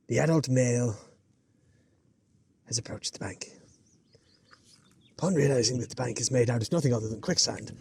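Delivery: mock nature documentary voice over the river bank; nature documentary narrator. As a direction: narrate like a hushed wildlife presenter.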